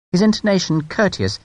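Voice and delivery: plain reading voice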